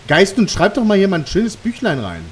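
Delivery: high, loud call to the ghost; yelling across the room. Real, not acted. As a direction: shout it into the empty room. (laut in den Raum rufen)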